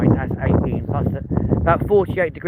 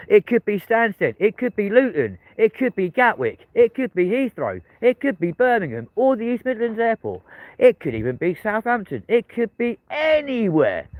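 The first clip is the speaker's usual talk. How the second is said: teasing announcer list; 8 semitones higher than usual. Acted. Teasing airport guesses.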